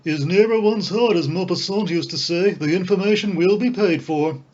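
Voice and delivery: breezy Ostap. A narrator's reading. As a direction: toss it off grandly. Ostap bargaining with the clerk.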